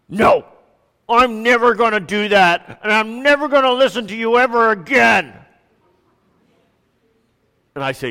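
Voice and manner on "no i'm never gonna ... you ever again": put-on higher, louder whiny kid refusing; defiant grumpy child. Son defying his dad, in a higher, louder grumpy whine. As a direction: play a sulky boy digging his heels in, higher and louder. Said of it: "he'll get this grumpy look on his face".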